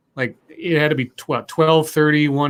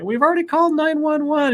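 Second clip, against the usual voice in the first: high-pitched imitated call across a street; 12 semitones higher than usual. Neighbors answering the screamer, high imitated call.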